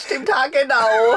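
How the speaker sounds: high laughing words, then a giggle. Real, not acted. Laughing at the wife's remark. (lachend gesprochen)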